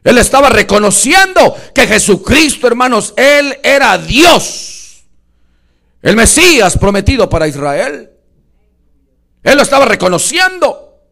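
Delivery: impassioned pulpit shout; full-force preaching. Real, not acted. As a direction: hammer the revelation home from the pulpit.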